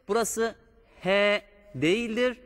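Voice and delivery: normal talking voice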